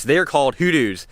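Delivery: ordinary talk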